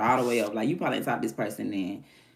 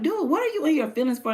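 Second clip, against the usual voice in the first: high, incredulous mock comeback; exasperated retort; 13 semitones higher than usual. Answering the sulking guy, exasperated and incredulous. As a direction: scoff at him as you fire back.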